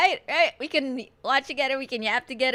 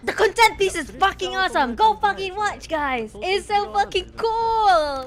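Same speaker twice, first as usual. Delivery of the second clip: louder, higher hype; hyped up; 5 semitones higher, 5 dB louder than usual. Real, not acted. Streamer urging chat to watch, loud hype. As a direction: sell it to them, fired up. Hyping the content piece to chat.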